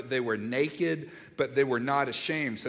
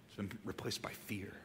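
whisper; 6 semitones lower, 14 dB quieter than usual. Real, not acted. Sermon drops to a hush.

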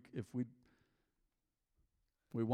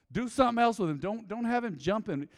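retold instruction; 5 semitones higher, 5 dB louder than usual. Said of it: "I'm like".

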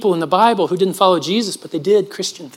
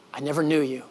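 hushed solemn quote; 5 semitones lower, 10 dB quieter than usual. Acted.

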